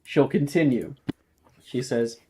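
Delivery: ordinary talk, nothing marked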